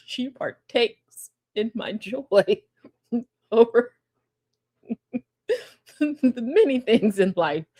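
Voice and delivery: slow, laughing through words. Real, not acted. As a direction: giggle your way through it.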